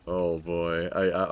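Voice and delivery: ordinary talk, nothing marked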